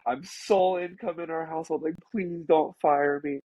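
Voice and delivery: high, rushed, begging not to be fired; panicked pleading. Retold or imitated. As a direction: grovel in a panic, pitched high. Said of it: "I immediately go into damage control mode".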